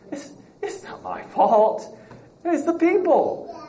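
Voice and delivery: high, slow put-on excuse voice with a laugh; mocking whiny Saul. Teacher mocking Saul's excuse to the class, whiny. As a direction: whine it like a kid dodging blame.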